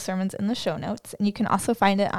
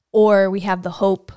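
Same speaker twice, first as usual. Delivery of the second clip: ordinary delivery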